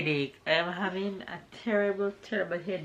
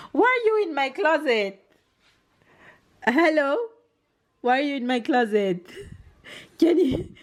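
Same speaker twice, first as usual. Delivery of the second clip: amused scolding; 7 semitones higher, 4 dB louder than usual. Real, not acted. Finds toddler in closet.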